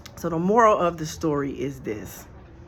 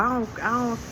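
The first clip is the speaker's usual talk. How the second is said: voicing the ex-prisoner; 6 semitones higher than usual. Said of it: "he got his whole story out".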